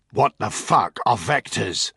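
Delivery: slow, forceful questioning; angry demand. Acted. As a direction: demand it with heavy, slow words. Interrogator demands an explanation.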